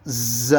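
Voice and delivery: ز with a long hard z buzz before the vowel; forceful buzzed ز. Shown as a demonstration. Teacher demonstrating the letter, ز strongly buzzed. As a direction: buzz ز like a skidding car. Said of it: "اس طریقے پر".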